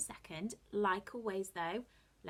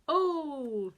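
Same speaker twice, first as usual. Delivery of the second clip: superhero voice; 5 semitones higher, 11 dB louder than usual. Shown as a demonstration. Teacher joins superhero sound drill.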